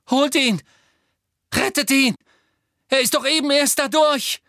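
high, loud frantic pleading; desperate shout. Acted. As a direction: beg them frantically to save him. Harry desperate, fighting Lupin. (Harry fleht verzweifelt und laut)

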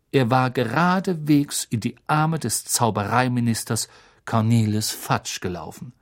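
ordinary delivery

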